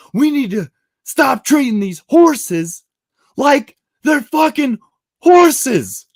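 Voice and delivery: high, loud, slow proclamation; rallying crowd shout. Retold or imitated. Addressing horse-carriage protesters with the floor, shouted proclamation.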